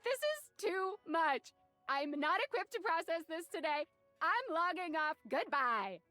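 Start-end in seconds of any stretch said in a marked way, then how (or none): 0.0-3.8 overwhelmed. Acted. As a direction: drag it out, exasperated. Overwhelmed by the absurd news.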